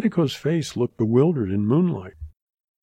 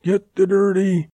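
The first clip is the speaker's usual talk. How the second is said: high, loud yell cut short; raised outlaw's shout; yelled order; 8 semitones higher, 5 dB louder than usual. A narrator's reading. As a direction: yell it out to the gang, breaking off. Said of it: "he yelled".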